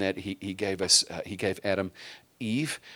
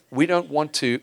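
usual speaking voice

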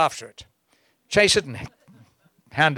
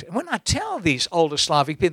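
ordinary talk

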